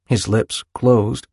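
ordinary delivery

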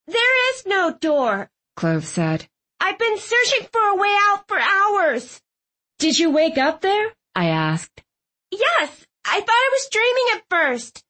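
high, voiced young heroine; Clove's voice. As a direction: lift the voice high for her.

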